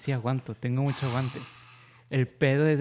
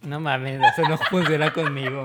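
being tickled; 8 dB louder than usual. Real, not acted. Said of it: "no puedo por las cosquillas".

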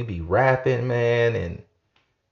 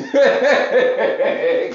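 loud, much higher burst of real laughter; roaring real laugh; big real laugh; 11 semitones higher, 10 dB louder than usual. Real, not acted. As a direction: crack up at your own punchline. Punchline laugh at his own trick.

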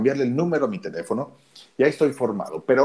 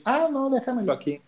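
higher, light voice of the office receptionist. Retold or imitated. Receptionist tells the job seeker to leave his résumé, higher voice. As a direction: play the bored receptionist taking his résumé.

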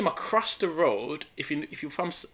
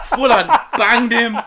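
laughing; 8 semitones higher, 8 dB louder than usual. Real, not acted. Laughing at his own story.

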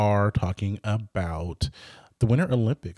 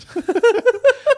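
high squealing laugh; 10 dB louder than usual. Real, not acted. Laughing at her accent mixup.

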